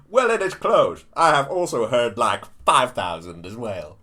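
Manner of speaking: loud, punchy politician impersonation; emphatic mock-presidential delivery; Trump.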